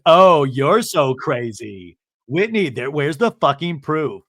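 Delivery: loud, drawn-out sarcastic echo; mocking retort. [name] echoing Justin's insult, in a loud mocking retort. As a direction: throw it back slowly and loudly. [name] throws his words back.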